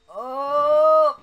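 long, high, loud rising "oh"; shocked yell. Real, not acted. Plane unexpectedly takes off.

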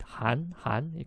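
"Han" with a rising tone; teacher showing "Han" going up; second tone. Shown as a demonstration. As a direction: let "Han" climb upward, softly. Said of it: "going up".

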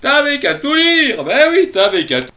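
high-pitched mimicked reproach; mock the cousin's told-you-so, pitched well up; imitated high, nagging cousin; scolding cousin. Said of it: "ah bah elle dirait".

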